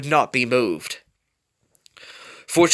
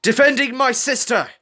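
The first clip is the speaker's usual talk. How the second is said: higher, louder sharp comeback; defiant retort; 12 semitones higher, 5 dB louder than usual. A narrator's reading. Rebel answering his brother Prowler, defiant raised retort. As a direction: throw it back in his face. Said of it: "Rebel retorted".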